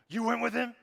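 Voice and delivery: high, loud outraged yell; explosive shout of disbelief at a boneheaded decision; parent scolding daughter. Acted. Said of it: "that's how you talk to your daughters".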